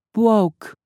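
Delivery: put-on high small girlish voice; innocent girl voice. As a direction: say it like a sweet little girl. Unicorn voice.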